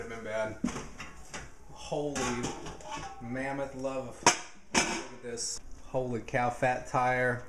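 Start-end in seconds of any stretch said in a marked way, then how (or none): none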